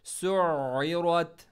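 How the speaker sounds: सुइरत slow and loud, ain from mid-throat; stressed ain. Shown as a demonstration. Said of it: "आइन को middle throat". (सुइरत में आइन गले के बीच से ज़ोर के साथ)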